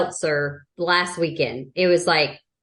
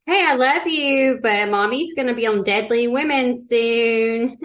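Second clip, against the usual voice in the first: mock mommy voice; 4 semitones higher than usual. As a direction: coo it to a baby as a joke. Joking mother talking to baby.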